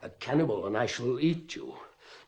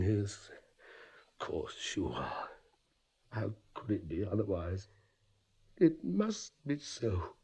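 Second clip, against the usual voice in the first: much lower, hushed reassurance; quiet self-assurance; 5 semitones lower, 8 dB quieter than usual. Acted. Father convinces himself she is his, low and hushed. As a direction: talk yourself into believing it.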